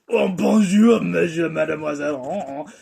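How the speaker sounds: put-on a cheesy Frenchman bursting into court, in a French accent. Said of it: "some guy from France ... says".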